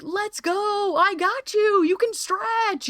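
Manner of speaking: high, slow encouraging call; encouraging cheer. Retold or imitated. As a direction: lift them up with a high cheer. Cheering quote, encouraging others.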